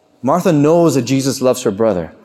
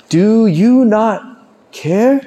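slow, higher-pitched appeal, rising on the last word; pleading question; 5 semitones higher, much slower than usual. Acted. Apostles appealing to sleeping Jesus in the storm, pleading. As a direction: draw out a reproachful plea.

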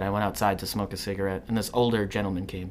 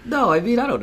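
high-pitched, loud protest; 12 semitones higher, 5 dB louder than usual. Real, not acted. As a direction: jump up into a squeaky protest.

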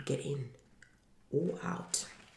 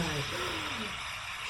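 long, soft sigh falling from high; heavy dying sigh, trailing away; great sigh; 9 semitones higher, 7 dB quieter than usual. A narrator's reading. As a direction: let out a last breath. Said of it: "with a great sigh".

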